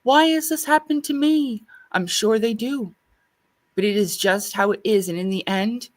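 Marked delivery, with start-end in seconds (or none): 0.0-1.6 higher-pitched plaintive question from a seed; wondering seedling. Acted. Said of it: "if they wonder".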